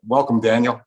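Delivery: ordinary talk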